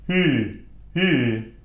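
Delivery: "Ü" as ee through rounded lips; rounded, isolated "Ü"; lips pushed forward. Shown as a demonstration. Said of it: "pushing the lips forward".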